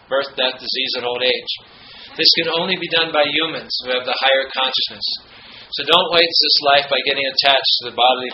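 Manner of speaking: firm sermon reading; preaching quote reading. Acted. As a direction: deliver the master's warning to the congregation. Reads Prabhupada quote aloud.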